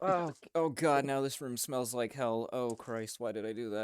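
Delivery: loud regret. Pretend. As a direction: cry out in mock horror at your own mistake. Player regrets the smell of burning.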